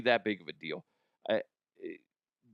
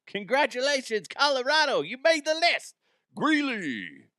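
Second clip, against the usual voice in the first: loud, high-pitched hammed-up cheer; mock congratulations; 5 dB louder than usual. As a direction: whoop it up like an over-the-top announcement.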